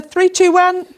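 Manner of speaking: rally the class to start; teacher counting in the class reading; loud countdown. Acted.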